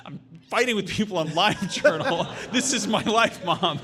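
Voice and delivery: put-on high, laughing teen self; laughing through the quote. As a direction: giggle out the excuse to mom. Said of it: "And I'm like".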